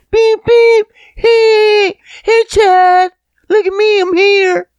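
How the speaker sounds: mock friendly hailing. Honking at a buddy at a red light, mock high-pitched calling. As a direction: squeal for a buddy's attention in mock excitement.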